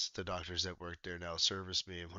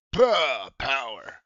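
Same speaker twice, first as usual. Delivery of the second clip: imitating the chiropractor; 5 semitones higher, 11 dB louder, much slower than usual. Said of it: "he's like".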